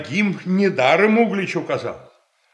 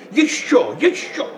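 mocking laugh; 6 semitones higher than usual. Boyar watching the crowd from the window.

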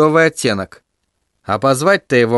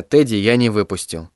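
ordinary delivery

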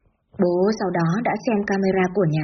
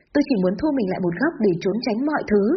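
ordinary delivery

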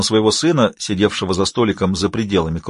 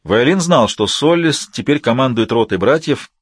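usual speaking voice

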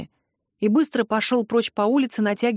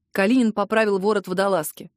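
ordinary delivery

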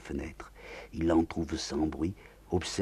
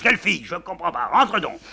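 higher, loud, confused; a touch of a blustering general; flustered general; 12 semitones higher, 9 dB louder than usual. Acted. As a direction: try to calm her down. General tries to answer.

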